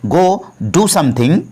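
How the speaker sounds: very slow, every word laid out for learners; slow, careful model sentence; slow teaching demo. Shown as a demonstration. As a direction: spell it out slowly for the class. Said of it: "suggestion देते समय कहते हैं".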